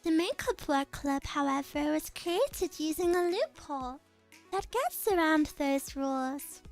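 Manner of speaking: put-on composed noble girl. Same character voice continues.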